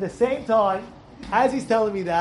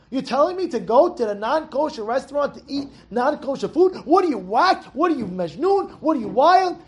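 angry scolding; 5 semitones higher than usual. Shown as a demonstration. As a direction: scold the tempter in disbelief. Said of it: "Get angry at him".

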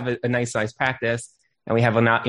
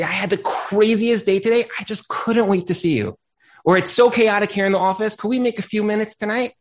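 lifted, higher voice of a caring husband; loving husband; 6 semitones higher than usual. Retold or imitated. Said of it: "He's gonna say".

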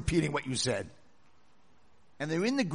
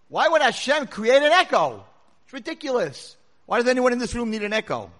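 loud, higher-pitched exasperated questions; incredulous questioning; 7 semitones higher, 11 dB louder than usual. Acted. Kid asking his father about echoes, exasperated. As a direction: demand an answer, voice raised and high.

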